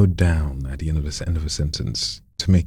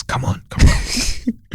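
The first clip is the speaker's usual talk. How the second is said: breathy coaxing; much slower than usual. Acted. Coach urging student on.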